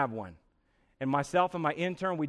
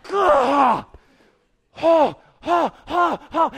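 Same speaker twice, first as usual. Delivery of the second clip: loud high huffing "oh"s; hammed-up groan, then repeated panting cries; panicked groans; 7 semitones higher, 7 dB louder, much slower than usual. Man freaking out before confronting someone, loud panicked huffs.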